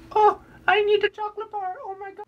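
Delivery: loud falsetto wail; hammed-up high-pitched Swedish tantrum; mock Swedish customer. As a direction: wail desperately in a very high silly voice. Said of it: "making stuff up as I go with the voice".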